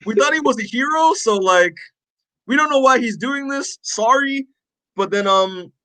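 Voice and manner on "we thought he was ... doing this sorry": much higher, quick and sheepish; high sheepish apologetic heroes; mock hero apology. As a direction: squeak out an awkward apology. Voicing heroes apologizing to Mark.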